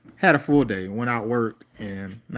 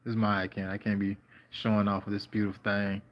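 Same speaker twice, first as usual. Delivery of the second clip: normal talking voice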